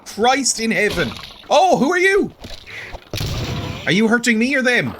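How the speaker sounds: frightened yelps under attack; panicked. Real, not acted. Attacked by skeletons.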